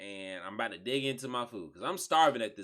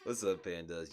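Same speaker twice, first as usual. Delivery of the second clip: ordinary talk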